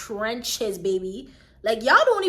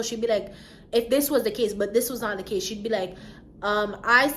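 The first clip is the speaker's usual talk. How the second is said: ordinary talk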